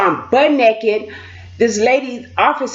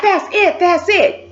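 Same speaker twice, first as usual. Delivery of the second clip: high, mimicked cut-off order; fed-up officer; 8 semitones higher, slower than usual. Officer giving up on her peeing, fed up. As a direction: call a halt, imitating the officer. Officer ending the visit attempt.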